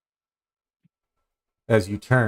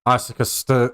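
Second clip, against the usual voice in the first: ordinary delivery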